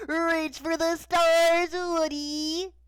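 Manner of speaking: put-on high, loud, drawn-out kid's holler; kid yelling. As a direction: cry it out high, stretching every word. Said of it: "some random kid picked up Woody and yelled".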